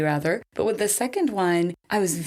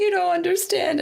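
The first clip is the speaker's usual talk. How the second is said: tearful protest; 11 semitones higher than usual. Retold or imitated. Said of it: "And I'm just crying".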